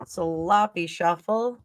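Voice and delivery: slowly savoring each word; slow drawn-out repeat. Shown as a demonstration. Reader repeating the card shuffle's name, slowly drawn out. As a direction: stretch out sloppy shuffle, lingering on shuffle.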